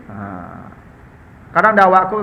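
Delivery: ordinary talk, nothing marked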